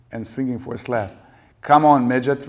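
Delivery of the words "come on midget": loud, raised-pitch taunt; furious, goading shout; bellowing. A narrator's reading. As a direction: bellow a challenge at him. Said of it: "Rachel bellowed".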